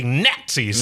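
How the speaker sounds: "Nazis" said in the character's accent; accented pronunciation. Shown as a demonstration. Co-reader corrects the accent, demonstrating "Nazis" with a punched first syllable. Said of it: "If you're gonna do that accent".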